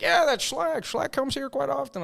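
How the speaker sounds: high-pitched imitated bar regular; put-on answering voice. Bar man answers about the sticker, put-on high voice. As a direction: pitch it way up for the quote.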